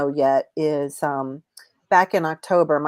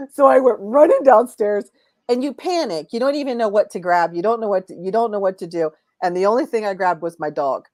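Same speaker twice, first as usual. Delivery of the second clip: agitated; 4 semitones higher, 4 dB louder than usual. Real, not acted. Retelling fire panic.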